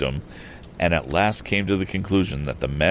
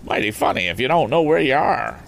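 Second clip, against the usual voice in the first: higher rustic skeptical comment; farmer's voice; 7 semitones higher than usual. Acted. Farmer doubting the lost stranger, higher rustic comment. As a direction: remark doubtfully as a country farmer. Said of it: "commented the farmer".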